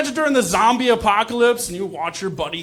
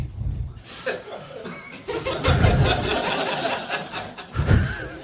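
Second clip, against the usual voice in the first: audience roaring with laughter; big crowd laugh; 6 dB quieter than usual. Real, not acted. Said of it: "why you guys laughed at that".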